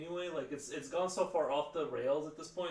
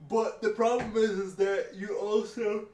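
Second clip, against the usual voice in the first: talking through a yawn; 6 semitones higher, 7 dB louder than usual. Real, not acted. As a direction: stretch the words out in a big yawn. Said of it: "Sorry for the yawning".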